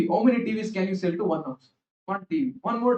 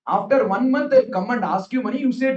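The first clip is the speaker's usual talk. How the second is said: usual speaking voice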